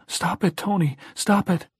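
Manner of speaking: put-on high, desperate child cry; pleading in panic; frightened cry. A narrator's reading. Child crying out.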